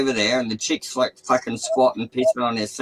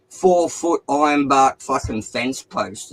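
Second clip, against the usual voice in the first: ordinary talk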